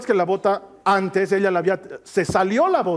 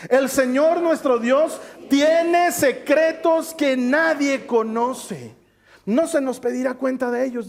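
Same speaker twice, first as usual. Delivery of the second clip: slower and higher, leading the room through the verse; slow group reading; 7 semitones higher, 4 dB louder, slower than usual. Shown as a demonstration. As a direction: read it together, drawing each word out. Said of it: "Otra vez vamos a leerlo juntos".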